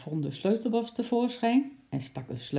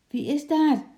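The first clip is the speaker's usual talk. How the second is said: much higher, louder call into the dark; 11 semitones higher, 7 dB louder than usual. Acted. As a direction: call out loud, unsure who's there. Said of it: "riep hij".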